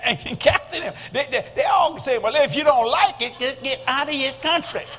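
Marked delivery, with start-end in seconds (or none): 2.2-4.9 high-pitched mock scolding; mock indignant. Passengers telling the driver to get out, mock indignant. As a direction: imitate a riled-up back seat.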